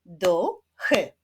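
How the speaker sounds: "Duchy" with a gap between the two syllables; split into syllables. Shown as a demonstration. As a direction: clap out "Duchy" beat by beat. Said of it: "wyklaszczmy sylaby w wyrazie".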